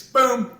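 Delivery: loud, high spoken boom; sudden booming sound effect; imitated explosion. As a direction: punch out a grenade blast. Imitates the grenades going off.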